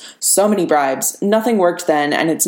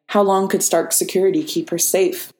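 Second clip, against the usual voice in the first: usual speaking voice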